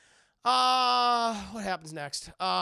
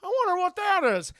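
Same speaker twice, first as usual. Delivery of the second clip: high, loud, hammed-up innocent wondering; mock naive heroine; 14 semitones higher, 12 dB louder than usual. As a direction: play the clueless heroine, way up high. Heroine voiced in retelling.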